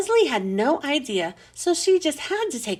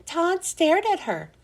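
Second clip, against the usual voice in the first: plain reading voice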